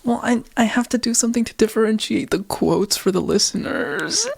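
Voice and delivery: high-pitched defensive plea; whiny protest. Real, not acted. Laughing self-defence after being scolded.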